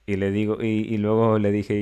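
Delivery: normal talking voice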